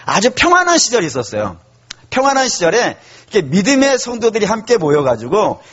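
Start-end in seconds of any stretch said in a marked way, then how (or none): none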